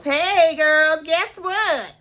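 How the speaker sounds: high, drawn-out imitation announcing news; hammed-up high-pitched impression. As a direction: call out slowly in a high put-on voice. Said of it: "I do my [name]".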